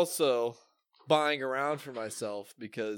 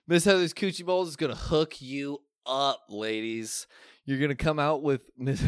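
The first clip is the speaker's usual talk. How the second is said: loud, hyped commercial pitch voice; ad read; 3 semitones higher, 5 dB louder than usual. Acted. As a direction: sell it like a cheesy radio ad. Spoof sponsor ad read.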